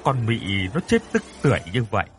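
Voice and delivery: ordinary delivery, nothing marked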